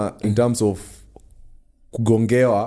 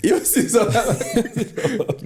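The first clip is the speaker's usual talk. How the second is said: slow, laughing, high South African accent imitation; 10 semitones higher, much slower than usual. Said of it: "that's not a South African accent".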